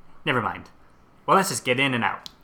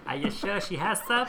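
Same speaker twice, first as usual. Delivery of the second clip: put-on old lady voice; 8 semitones higher, 4 dB quieter than usual. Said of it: "that's getting better".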